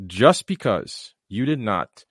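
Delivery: normal talking voice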